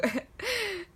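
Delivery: high airy laugh falling away. Real, not acted. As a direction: let a high laugh tumble out. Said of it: "sorry, I'm laughing because".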